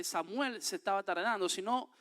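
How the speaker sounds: normal talking voice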